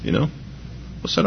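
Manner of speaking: ordinary delivery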